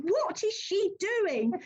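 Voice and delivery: mildly incredulous retelling; mock bafflement. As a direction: imitate a family's bewildered aside, drawn out. Retelling baffled family's reaction.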